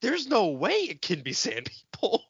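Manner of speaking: ordinary talk, nothing marked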